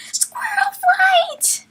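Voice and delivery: drawn out breathy high call, very slow; long drawn cry. Acted. Shadowsight crying after the fleeing Squirrelflight, a long airy drawn call. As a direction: stretch her name into a long airy cry.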